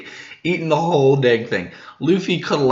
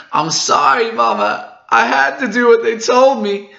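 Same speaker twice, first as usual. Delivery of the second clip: high, loud pleading cry; pleading yell; 12 semitones higher, 6 dB louder than usual. Acted. Daughter yelling an apology to Mama, pleading cry. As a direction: beg forgiveness at the top of your voice.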